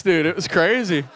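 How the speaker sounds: ordinary talk, nothing marked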